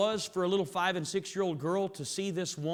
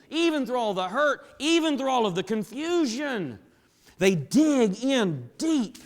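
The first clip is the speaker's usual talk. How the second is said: rising, louder preaching build; preaching at full force; 4 semitones higher, 4 dB louder than usual. Acted.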